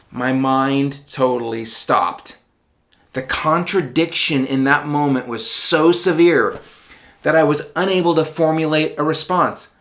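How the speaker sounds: plain reading voice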